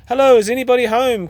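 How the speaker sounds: high, loud call through the door. A narrator's reading. Tom calling out at Pete's door. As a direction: call out from the doorway. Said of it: "called a voice".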